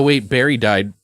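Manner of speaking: usual speaking voice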